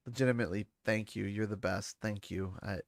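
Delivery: low, soft, tearful thanks; real choked-up gratitude, hushed; close to tears. Real, not acted. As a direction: hold back tears while giving quiet thanks. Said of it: "I made myself cry".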